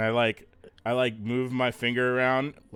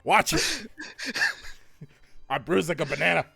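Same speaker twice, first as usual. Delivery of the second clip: playful, high-pitched complaint; hammed-up indignant protest; mock protest; 5 semitones higher than usual. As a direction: yelp a warning, half laughing. Player protests after being punched.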